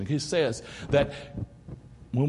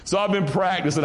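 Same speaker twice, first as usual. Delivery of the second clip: ordinary talk, nothing marked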